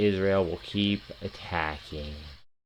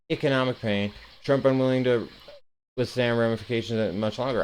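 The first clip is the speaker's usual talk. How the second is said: ordinary talk, nothing marked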